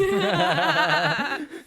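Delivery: loud, high burst of laughter; real high-pitched guffaw; big laugh. Real, not acted. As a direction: crack up out loud. Laughs at the dog remark.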